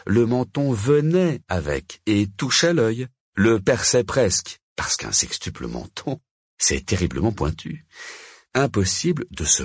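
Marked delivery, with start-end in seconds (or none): none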